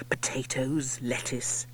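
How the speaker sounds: hushed whispered list; whispering. A narrator's reading. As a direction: whisper the vegetables. Listing the stolen vegetables.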